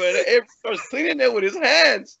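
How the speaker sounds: usual speaking voice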